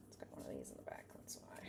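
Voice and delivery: breathy whispered aside; whispering. Real, not acted. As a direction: murmur it under your breath. Quiet aside about a card.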